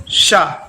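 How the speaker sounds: a clear palatal शा, sh sound; palatal sha demo. Shown as a demonstration. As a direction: say शा with tongue touching the palate. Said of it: "इसको बोल के देखिए".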